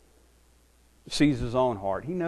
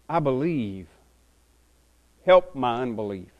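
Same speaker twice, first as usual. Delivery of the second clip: rising, louder reading of the verse; scripture reading; 4 semitones higher, 10 dB louder than usual. Acted. Preacher reading scripture to the congregation, fervent. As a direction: read it out with fervour to the church.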